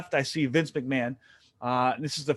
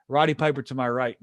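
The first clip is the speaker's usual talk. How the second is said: normal talking voice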